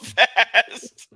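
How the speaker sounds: drawn-out words through real laughter; laughing. Real, not acted. Cohost laughing at a dark joke, laughing. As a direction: laugh it out slowly, very loud.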